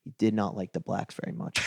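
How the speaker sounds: hushed confidential aside; softly, as if checking nobody overhears; furtive old man. Retold or imitated. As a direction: imitate an old man lowering his voice to share a secret. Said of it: "then he looked around".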